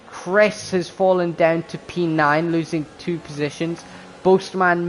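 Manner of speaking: excited, high play-by-play; motorsport commentator. Acted. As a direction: call the race start live. Live race start play-by-play.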